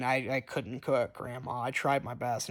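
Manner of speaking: dejected. Retold or imitated. Charlie apologizing to grandma.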